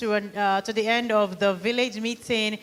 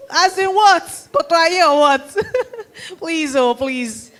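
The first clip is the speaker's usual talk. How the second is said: normal talking voice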